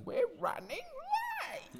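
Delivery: tiny falsetto screech, held back in volume; drawn-out, squeezed mock scream; mock screaming banshee. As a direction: imitate a banshee yelling, slow and sky-high. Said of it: "turn into a screaming banshee and just start yelling".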